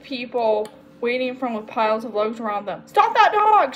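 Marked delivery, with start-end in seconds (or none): 2.9-3.7 high, loud call for help; crying out. A narrator's reading. Samantha calling to waiting people, crying out. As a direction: shout across to a crowd. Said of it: "she cried".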